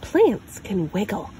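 usual speaking voice

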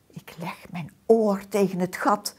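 plain reading voice

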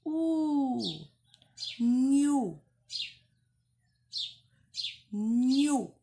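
U then Nhu, high and loud; syllable modelling. Shown as a demonstration. Teacher modelling Nhu for young readers. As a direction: sound out Nhu slowly for children. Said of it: "essa outra vogal aqui".

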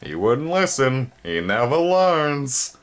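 disappointed chiding, drawn out; chiding parents. Acted. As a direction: shake your head at the boy. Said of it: "voices of Spelda and Tumtum chiding him".

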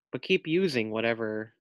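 ordinary delivery, nothing marked

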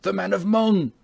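high, urgent shout; called-out reply. Acted. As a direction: call it back while rushing away. Shouted reply while rushing off.

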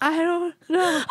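mock tearful child through laughter; laughing through a pretend cry; laughing imitated sob. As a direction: squeak it out between laughs like a guilty kid. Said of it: "And I was like".

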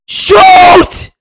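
very high, loud shout to shoot now; urgent yell. Real, not acted. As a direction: bark the order as the clock runs out. Last seconds, urging a shot.

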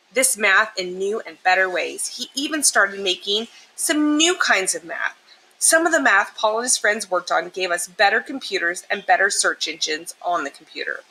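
ordinary delivery

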